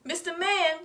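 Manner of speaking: high calling out; Blinky calls again for the neighbor; mild yell. A narrator's reading. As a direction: call him by name. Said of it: "Blinky yell".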